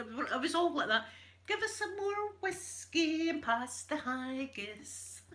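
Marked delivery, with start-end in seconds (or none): 1.5-5.2 slow, higher put-on Scottish voice; mock Scottish character. Mimicking a Brigadoon villager in a Scottish accent. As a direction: ham up a Highland villager in a musical.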